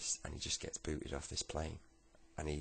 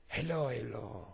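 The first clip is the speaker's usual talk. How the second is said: higher, drawn-out French greeting; rough French accent; 5 semitones higher, 4 dB louder than usual. Acted. Homeless man greets the lad, rough French accent. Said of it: "I can't do a brilliant French accent".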